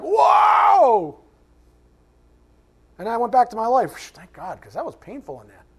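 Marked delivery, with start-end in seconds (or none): 0.0-1.2 high, loud mock shout of shock; startled whoa. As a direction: yelp like the mirror scared you. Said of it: "I looked in the mirror, I was like".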